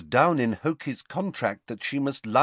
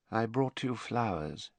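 said quietly; 7 dB quieter than usual. A narrator's reading. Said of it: "he said quietly".

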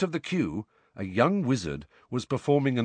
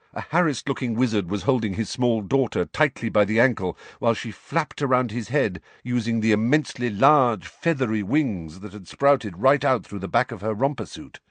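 usual speaking voice

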